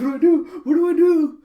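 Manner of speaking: high, loud cartoon panic; hammed-up, high-pitched panic; panicked. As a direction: fret frantically at the top of the voice. Dog panicking in the story.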